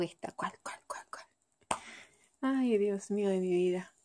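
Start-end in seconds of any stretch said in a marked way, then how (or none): 0.0-1.3 frantic unvoiced whisper. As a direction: whisper the impossible choice. Torn mom's inner dilemma again.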